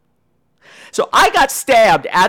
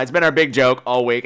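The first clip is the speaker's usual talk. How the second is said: normal talking voice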